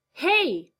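loud high shout after someone; calling out. Acted. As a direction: yell to stop them leaving. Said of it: "he called to the retreating figure".

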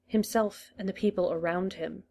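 usual speaking voice

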